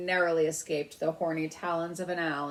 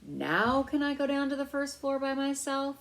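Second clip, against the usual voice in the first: put-on higher eager young mouse; a small mouse asking eagerly, voice lifted high; 8 semitones higher, 4 dB louder than usual. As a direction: beg with bright eagerness. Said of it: "he asked eagerly".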